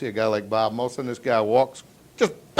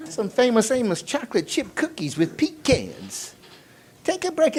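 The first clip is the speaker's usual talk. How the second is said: put-on old man; 9 semitones higher, slower than usual. Old man tempting the salesman to quit early, high coaxing voice. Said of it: "an old man said to me".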